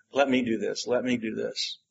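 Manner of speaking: quietly eager, begging to help; hushed and insistent, let me do this; a soft, earnest plea; soft pleading. Retold or imitated. Disciple pleading to serve Jesus.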